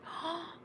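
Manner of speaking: sharp mock-shocked gasp; a quick startled intake of breath. Real, not acted.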